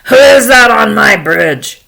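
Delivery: loud troll challenge; forceful, menacing demand; horrible troll. Acted. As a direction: bellow it as the bridge's monster. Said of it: "there was this horrible troll".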